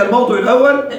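ordinary talk

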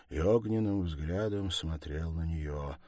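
ordinary delivery, nothing marked

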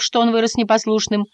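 plain reading voice

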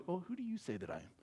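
very soft, quick, timid asking; mock meek question. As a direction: murmur it meekly, barely daring to ask. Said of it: "Jesus isn't meekly asking Peter alone".

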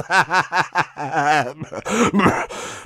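crazed burst of laughter; mad laughter. Acted. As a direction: laugh like a madman. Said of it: "он расхохотался".

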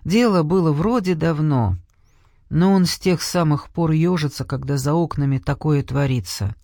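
ordinary delivery, nothing marked

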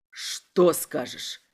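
low, slow threatening question; slow, deep-pitched interrogator; menacing inspector. A narrator's reading. Inspector presses the prisoner.

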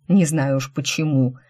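ordinary delivery, nothing marked

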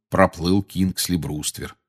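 plain reading voice

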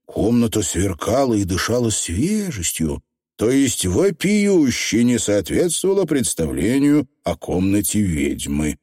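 ordinary delivery, nothing marked

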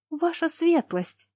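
high, slow, startled cry; alarmed gasp. A narrator's reading. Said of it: "Лана охнула".

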